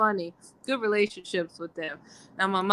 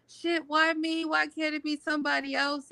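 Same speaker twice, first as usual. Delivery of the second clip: high, softer mock protest; 9 semitones higher, 5 dB quieter than usual. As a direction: plead why it has to be you, pitched up. Retold protest at being chosen.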